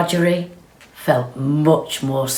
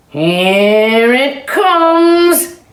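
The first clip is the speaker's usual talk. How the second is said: very slow, loud and higher call; shout; 8 semitones higher, 7 dB louder, much slower than usual. Acted. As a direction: shout it across the barn. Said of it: "shouted one of the cows".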